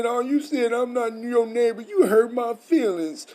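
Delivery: high, loud whiny mock sob; mock crying. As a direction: mimic a crybaby whining, hammed-up. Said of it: "start crying and say".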